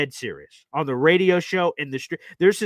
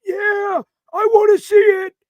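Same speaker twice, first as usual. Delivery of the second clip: put-on high, loud old man shout; an eager old man yelling his hope; hammed-up old man yelling; 8 dB louder than usual. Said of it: "And then he goes".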